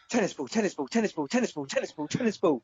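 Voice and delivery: mock Brummie dog, rattling it off; hyperactive Brummie. Hyper dog begging to play, put-on Brummie accent. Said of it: "just imagine a Brummie going".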